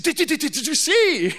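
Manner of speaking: high, loud, stammering with excitement; breathless excitement. Retold or imitated. Brother excitedly stammers to his sibling about the rattler. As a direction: sputter it out like a thrilled kid.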